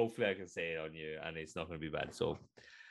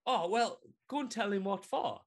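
pitched up, urging her on; high voice of a stranger stirring her up; high egging-on voice; 11 semitones higher, 3 dB louder than usual. Acted. Man on the bus urges the mother, pitched high. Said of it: "and he was like".

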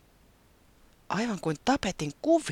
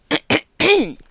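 throat clearing; 12 dB louder than usual. A narrator's reading. Taputi about to speak.

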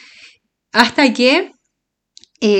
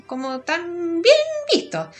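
high, slow, prissy "como tan bien visto"; squeaky mock-prim aside; 10 semitones higher, slower than usual. Real, not acted. (tono agudo y lento de mojigata)

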